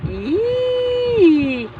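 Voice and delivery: long, high-pitched "yee"; playful squeal. Pretend. Parent amusing baby, high playful squeal. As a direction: let out a teasing rising squeal at a baby.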